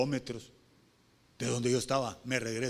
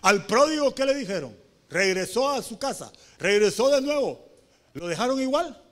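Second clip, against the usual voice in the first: raised, high-pitched sermon delivery; impassioned preaching; 7 semitones higher, 6 dB louder than usual. Acted. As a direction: preach with mounting urgency. Preaches at full force.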